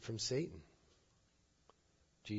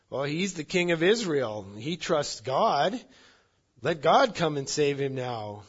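loud, raised mocking jeer; taunting; 4 semitones higher, 8 dB louder than usual. Chief priests taunting Jesus on the cross, loud and mocking. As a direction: throw the challenge out loudly at a condemned man. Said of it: "they start taunting Jesus with".

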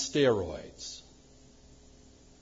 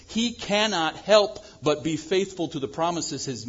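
normal talking voice

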